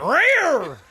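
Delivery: high, loud mock screech; imitated noise. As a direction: yelp it out as a sound effect. Imitates noise of painful swab jab.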